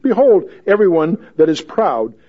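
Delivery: God's voice, loud and slow; booming God. Acted. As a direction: thunder God's challenge at Job. God's challenge read loud, slow.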